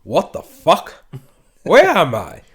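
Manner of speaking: loud, high, slow pompous outrage; hammed-up imitation of Laszlo demanding release; indignant Laszlo. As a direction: bellow like an offended aristocrat waking in a cage. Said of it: "he wakes up in the cage and I say".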